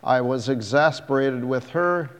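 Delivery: ordinary delivery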